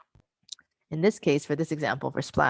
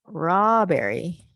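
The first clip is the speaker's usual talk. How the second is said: slow drill; 3 dB louder, much slower than usual. Shown as a demonstration. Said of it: "and then".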